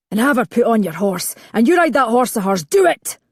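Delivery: building to a loud, high bark on "do it"; barked order. A narrator's reading. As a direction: order the men about, rising to a bark. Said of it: "he barked at the other men".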